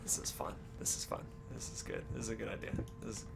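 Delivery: whispered, dry sarcasm; sarcastic whisper. Real, not acted. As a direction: whisper it like you mean the opposite. Whispering sarcastic comments in haunted house.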